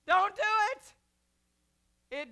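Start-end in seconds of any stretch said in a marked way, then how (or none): 0.1-0.9 high loud quick warning; comic loud repeat. Acted. Preacher repeating his comic warning, loud and high. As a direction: yell it once more for laughs.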